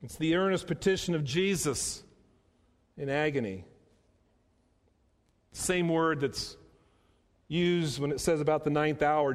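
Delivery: normal talking voice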